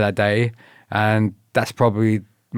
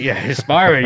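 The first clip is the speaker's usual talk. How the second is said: laughing; 5 semitones higher, 5 dB louder than usual. Real, not acted. Laughing while agreeing.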